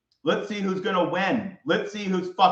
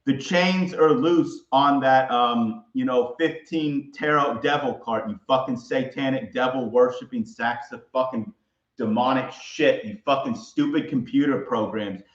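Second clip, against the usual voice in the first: usual speaking voice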